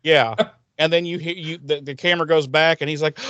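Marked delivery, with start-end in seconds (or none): none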